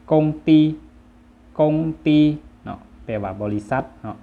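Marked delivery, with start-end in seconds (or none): 0.1-2.2 กงตี as Vietnamese, high, level and loud; Vietnamese pronunciation. Shown as a demonstration. Teacher models the word for company, Vietnamese pronunciation of กงตี. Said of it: "ภาษาเวียดจะอ่านว่า".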